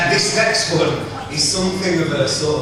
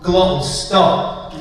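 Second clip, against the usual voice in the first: normal talking voice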